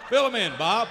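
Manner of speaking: loud, hearty call across the room. Acted. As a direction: call him over. Calling to guest across studio.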